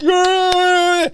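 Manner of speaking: high hammed-up crowd cheer; a playful shouted yeah; mock cheer. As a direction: imitate a whole chamber cheering along. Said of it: "everyone's like".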